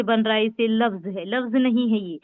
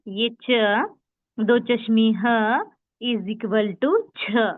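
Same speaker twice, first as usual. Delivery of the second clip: very slow, each letter drawn out; slow sound demo; much slower than usual. Shown as a demonstration. Teacher making students hear छ, slow and deliberate. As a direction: sound out the equation slowly for beginners. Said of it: "देखिए, आवाज सुने".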